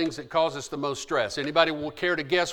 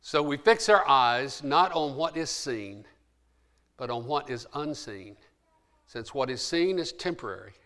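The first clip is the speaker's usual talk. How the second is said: ordinary talk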